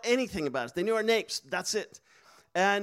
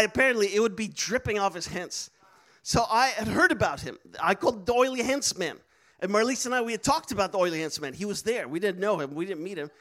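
ordinary talk, nothing marked

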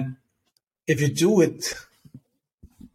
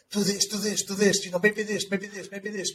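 higher-pitched, frantic inner voice; stressed brain chatter; 6 semitones higher, 3 dB quieter than usual. Acted. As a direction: imitate a brain throwing out frantic suggestions. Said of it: "when it's stressed, when it's in fight or flight mode".

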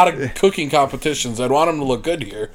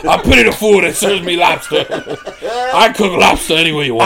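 raised trash talk voice; wrestling promo; 3 semitones higher than usual. Pretend. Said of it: "cut a promo".

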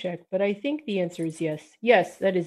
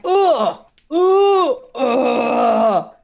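loud, high pained grunts and yelps; Pasha's pained cries, hammed up; mock pained grunts; 5 semitones higher, 9 dB louder, much slower than usual. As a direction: yelp in pain, hammed up. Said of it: "Pasha is like".

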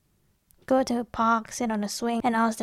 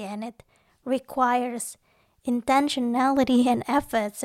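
ordinary talk

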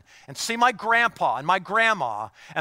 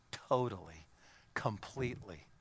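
whisper; 6 semitones lower, 10 dB quieter than usual. Acted. Hushed close of quote.

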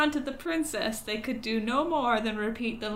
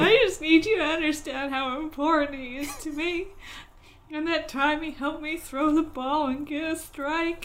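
higher, louder, earnest pleading; pleading role voice; 5 semitones higher, 4 dB louder than usual. Pretend. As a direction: play the desperate friend-seeker. Playing the grieving searcher.

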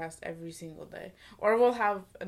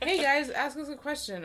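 bright host voice; 8 semitones higher, 4 dB louder than usual. Retold or imitated. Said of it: "reading the tag".

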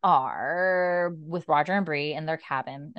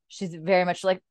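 ordinary talk

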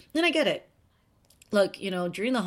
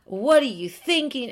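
raised, stretched-out imitation of scolding critics; mock outrage; 4 semitones higher, 5 dB louder than usual. Online critics attacking her name pronunciation, mock outrage. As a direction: scold loudly, drawing out the insult.

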